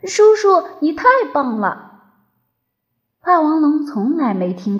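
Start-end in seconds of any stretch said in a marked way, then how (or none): 0.0-1.8 put-on high, delighted child dinosaur; excited little-kid cheer; happy shout. A narrator's reading. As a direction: cry out with joyful admiration. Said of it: "抽抽搭搭高兴地喊道".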